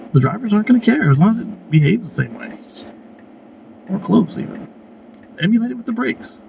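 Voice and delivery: talking while chewing gum; words around a wad of gum. Real, not acted. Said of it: "Pardon my gum chewing".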